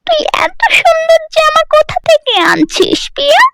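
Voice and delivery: put-on very high, shrill-pitched taunting girl; nosy village girl. Village girl quizzing Priya about her new dress, taunting. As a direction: demand an answer like a jealous neighbour girl. Jealous friend questions Priya.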